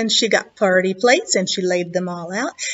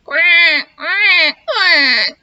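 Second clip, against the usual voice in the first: loud, higher sobbing quacks; crying duck quacks; 7 semitones higher, 6 dB louder, much slower than usual. Acted. Hurt duck crying outside mouse's door, sobbing quacks.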